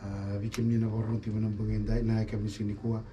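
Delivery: ordinary talk, nothing marked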